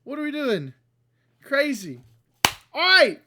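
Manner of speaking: ordinary talk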